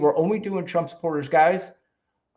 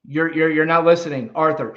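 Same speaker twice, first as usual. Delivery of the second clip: ordinary delivery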